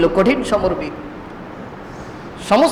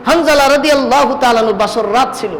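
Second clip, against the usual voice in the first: usual speaking voice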